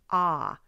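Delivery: "ah" held open and clear; clear ah sound. Shown as a demonstration. Teacher to student, a clear drawn "ah". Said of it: "the ah sound".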